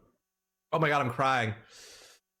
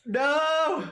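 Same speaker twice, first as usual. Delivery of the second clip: shocked yell; 8 semitones higher than usual. Real, not acted. Falls, screams then laughs.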